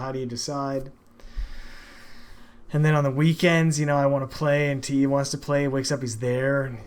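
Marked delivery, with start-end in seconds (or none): none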